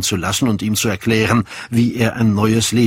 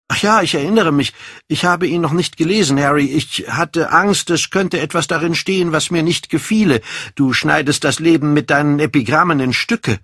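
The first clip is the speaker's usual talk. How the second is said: put-on high, agitated young Dorian; 8 semitones higher than usual. Dorian admits leaving Harry's letter unread, agitated. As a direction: explain it in a raised, agitated voice.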